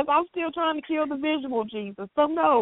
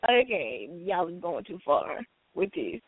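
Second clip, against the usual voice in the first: disapproving; 10 semitones lower, 5 dB quieter, slower than usual. Retold or imitated. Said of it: "I was like".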